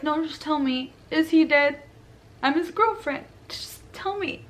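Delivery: high, frantic begging that rises to a near-cry; desperate pleading. Retold or imitated. Girlfriend begs the officer to say whether he's dead, frantic and high. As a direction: demand the truth through panic.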